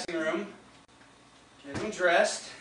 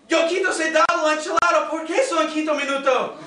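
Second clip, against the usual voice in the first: high, urgent mock Spanish; imitating an excited Spanish-speaking intruder; young Spanish speaker; 4 semitones higher, faster than usual. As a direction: rattle off agitated pretend Spanish. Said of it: "the strange young man says in Spanish".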